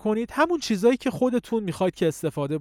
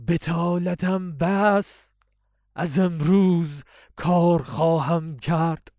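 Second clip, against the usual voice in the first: lower, slow recitation; deeper voice; much slower than usual. Shown as a demonstration. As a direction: bring the voice back down heavier and slow.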